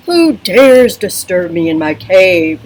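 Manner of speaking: loud booming growl; angry dragon voice. Acted. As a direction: roar it at the intruders from the cave. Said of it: "the dragon growled".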